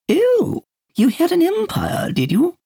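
very high, loud gushing hostess; eager hostess. Acted. Peter playing hostess to Dunbar, very high gushing. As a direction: coo like a delighted party hostess. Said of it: "in the voice of an eager hostess".

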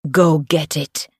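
lightly voiced thug's command; a hint of a menacing henchman; voiced order. A narrator's reading. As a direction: give a short, understated order. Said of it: "another voice ordered".

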